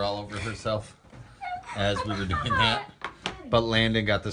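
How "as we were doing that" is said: laughing. Real, not acted. Laughing about the spill.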